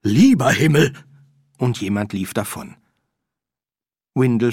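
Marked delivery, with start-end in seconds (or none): 0.0-0.9 loud, high, horrified cry; shocked cry. Acted. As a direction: cry out in fright at a corpse. Stranger sees undead Windle, flees.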